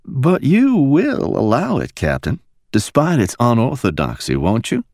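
Mickey softly coaxes the captain; soft coaxing. A narrator's reading. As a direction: coax him gently. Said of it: "she said softly".

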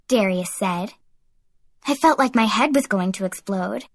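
plain reading voice

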